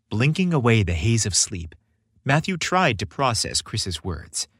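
plain reading voice

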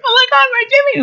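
squealing falsetto of mock amazement; hammed-up overjoyed woman, very high; gullible audience lady. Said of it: "She's like".

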